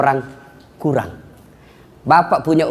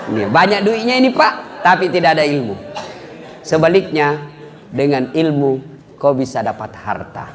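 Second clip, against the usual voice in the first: normal talking voice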